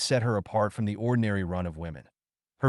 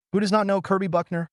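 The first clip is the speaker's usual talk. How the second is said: higher, louder and fast, laughing through it; mock him playfully with a laugh; 10 semitones higher, 4 dB louder, faster than usual. A narrator's reading. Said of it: "she laughed".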